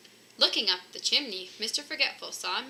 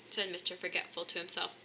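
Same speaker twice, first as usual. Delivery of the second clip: plain reading voice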